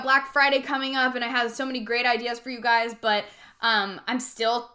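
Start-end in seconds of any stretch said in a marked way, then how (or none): none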